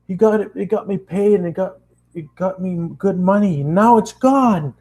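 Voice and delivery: put-on ranting to the girl about money; angry rant. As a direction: let the anger build to the loss.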